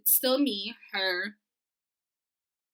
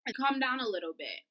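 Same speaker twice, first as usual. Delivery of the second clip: ordinary talk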